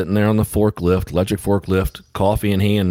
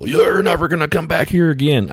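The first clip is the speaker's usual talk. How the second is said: higher mock voice of a huffy worker; indignant dock worker; 10 semitones higher than usual. Dock worker banning the driver, in a high mock huff. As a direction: squeak out the threat like a miffed clerk.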